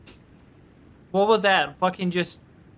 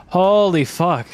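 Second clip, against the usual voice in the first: joyful exclamation; 5 dB louder, slower than usual. Real, not acted. Celebrating the win.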